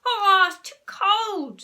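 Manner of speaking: loud, high, drawn-out screech. A narrator's reading. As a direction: screech the complaint at full volume. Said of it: "screeched Horrid Henry".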